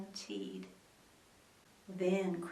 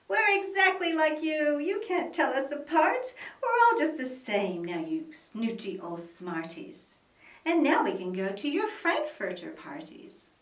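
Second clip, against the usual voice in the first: loud, high gloating yell; yelling taunt; 4 semitones higher, 7 dB louder than usual. Acted. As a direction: crow at the snooty ones. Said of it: "Then they yelled".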